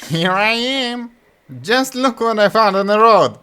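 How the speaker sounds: high, loud gleeful fool; excited Butthead. Acted. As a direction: show off your find. Butthead calls to brothers.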